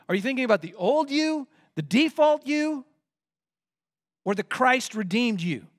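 insistent sermon questioning; emphatic preaching. Real, not acted. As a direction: challenge the congregation, stretching the key words. Challenges the congregation.

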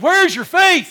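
high, loud, exasperated call; sharp loud question to disciples; loud rebuke. Retold or imitated. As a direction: challenge them sharply. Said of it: "He said".

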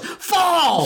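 high sudden yell. Retold or imitated. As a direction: yell it out sharply. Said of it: "one of the girls just yells".

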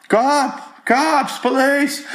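very high-pitched call for the cops; mock yelling. People yell for a passing street cop, mock yelling. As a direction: imitate someone hollering for police. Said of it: "waiting for people to yell".

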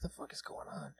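hushed whispered disbelief; whispering. Retold or imitated. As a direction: whisper it in shock.

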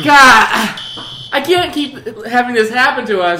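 loud exasperated yell; shouting over fire alarm. Acted. Acted alarm scene continues.

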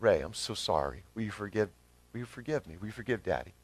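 soft, low and tender, saying sorry to a little son; gentle apology. Retold or imitated. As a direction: kneel down to his level and apologise gently. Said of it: "come to him on his level and say".

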